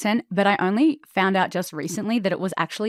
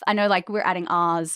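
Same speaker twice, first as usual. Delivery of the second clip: normal talking voice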